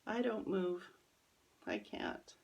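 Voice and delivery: usual speaking voice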